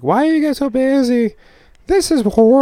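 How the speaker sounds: high, put-on voice of a fussy customer; mock whiny customer. Mocking a complaining restaurant customer, high whiny voice. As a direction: whine it like a customer who hates the wait.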